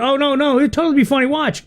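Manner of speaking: high, eager mock insistence; mock quirky insistence. Quirky friend insisting the pool jump is funny, high and eager. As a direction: squeak it out like a try-hard.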